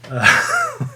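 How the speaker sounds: sudden high-pitched squeak. Real, not acted. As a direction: let out a startled squeak. After crude joke.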